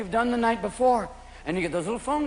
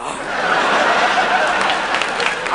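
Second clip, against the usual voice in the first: high, unhinged mock laugh; strained comic cackle; manic fake laugh; 14 semitones higher than usual. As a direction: laugh too hard to prove you're fine. Fake-fine reformed alcoholic.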